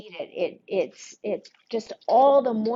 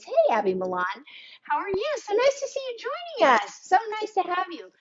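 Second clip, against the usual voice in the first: cheerful greeting; 10 semitones higher, faster than usual. Real, not acted. Greeting live viewers warmly.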